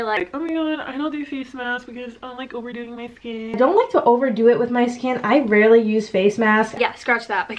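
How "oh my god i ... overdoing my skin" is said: softer, distant mock of past self; quoting myself. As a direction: toss off the old line lightly. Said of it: "remember when it was, like, yesterday".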